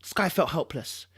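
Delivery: ordinary delivery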